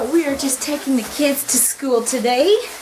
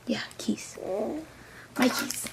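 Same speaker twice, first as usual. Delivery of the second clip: whispering; 10 dB quieter than usual. Real, not acted. Whispered to self.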